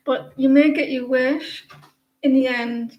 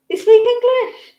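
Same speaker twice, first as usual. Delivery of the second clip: much higher and louder teasing squeal; high mock-incredulous pet talk; 11 semitones higher, 8 dB louder than usual. Real, not acted. Owner teasing her pet, high and loud.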